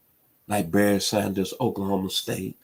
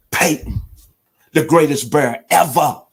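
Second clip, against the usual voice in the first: higher and louder, full of feeling; emotional proclamation; 6 semitones higher, 8 dB louder than usual. Real, not acted. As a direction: proclaim it loud and high, moved. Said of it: "I'm sorry if I'm getting emotional".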